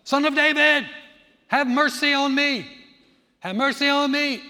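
loud, high crying out for mercy; yelling out. Retold or imitated. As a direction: call out across the crowd. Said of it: "they're yelling out".

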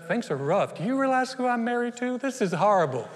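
hammed-up complaining, pitch jumping high midway; exaggerated woe-is-me griping; mock despair. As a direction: moan about your lot.

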